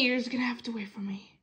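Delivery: fast quiet whisper; hurried breathy aside. Acted.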